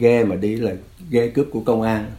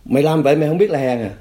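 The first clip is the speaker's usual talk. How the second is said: mock scolding, pitched up; badgering interrogator; 6 semitones higher, 4 dB louder than usual. Cadre taunting a prisoner as coward, acted anger. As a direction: press the prisoner with an indignant question.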